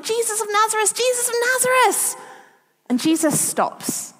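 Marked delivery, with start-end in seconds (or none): 0.0-2.2 calling out much higher, building to a cry; a blind beggar yelling after a passer-by; desperate shouting. Retold or imitated. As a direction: cry out to be heard over the crowd. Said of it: "Bartimaeus is shouting".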